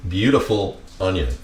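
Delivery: low, soft aside about the beautiful onion; half under the breath, admiring; murmured to the onion. Shown as a demonstration. Said twice, first softly.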